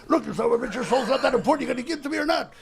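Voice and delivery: high, loud exasperated demand; angry devil. Acted. Devil grabbing a suicidal man by the throat, angry and loud. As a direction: devil losing his temper, voice climbing.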